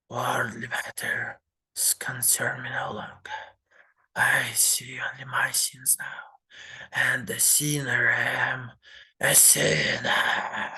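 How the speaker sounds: slow, rasping whisper of a guilt-mad grandfather; sinister old man whisper. Acted. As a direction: hiss out your sins like a man losing his mind.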